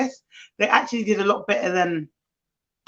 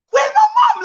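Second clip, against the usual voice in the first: put-on wailing toddler; 5 dB louder, faster than usual. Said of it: "He was like".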